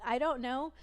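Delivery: normal talking voice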